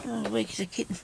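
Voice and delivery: high, breathy giggling through the words; laughing. Real, not acted. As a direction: laugh it off at yourself. Laughs at own weakness.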